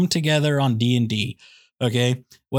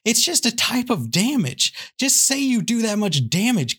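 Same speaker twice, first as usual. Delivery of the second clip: exasperated rant; 5 semitones higher than usual. Real, not acted. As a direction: whine at the nerds, Gosh dang it.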